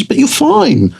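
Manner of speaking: very high, dismissive imitation of the wife; mock wife. Imitating his wife dismissing his panic, very high and breezy.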